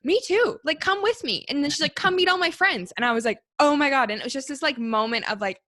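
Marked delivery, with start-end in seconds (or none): 0.0-1.4 put-on higher, louder bubbly backpacker girl; an upbeat girl inviting you along, much higher; excited girl. Girl voiced in story. 2.0-2.9 put-on higher, louder bubbly backpacker girl; an upbeat girl inviting you along, much higher; excited girl. Girl voiced in story. 3.6-4.1 louder, higher mock amazement; a happy imitated oh my God, pitched up; amazed. Stunned reaction retold.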